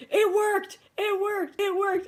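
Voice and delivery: high, loud, delighted repeats; thrilled. Retold or imitated. Sister-in-law thrilled the stain came out, high and loud.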